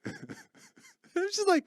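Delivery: high squeal of laughter through the words; breathless high giggle; laughing. Real, not acted. Laughing while retelling.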